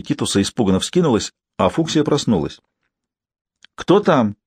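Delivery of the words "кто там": sharp high call through the door. A narrator's reading. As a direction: call out who's there. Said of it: "крикнула госпожа Шлак".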